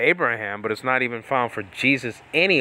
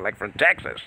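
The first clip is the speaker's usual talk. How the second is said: ordinary talk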